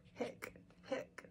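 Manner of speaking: breathy little hiccups; tiny voiceless hiccups; whispered hiccups. Acted. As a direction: hiccup under your breath.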